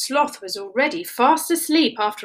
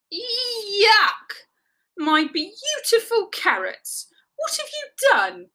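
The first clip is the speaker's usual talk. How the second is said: high, wailing disgust; 11 semitones higher, much slower than usual. A narrator's reading. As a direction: cry out in horror at it. Rabbit finds slimy carrots.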